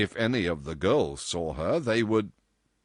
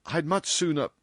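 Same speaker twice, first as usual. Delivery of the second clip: higher, unfinished protest; distressed; 9 semitones higher than usual. A narrator's reading. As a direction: break off in mild distress.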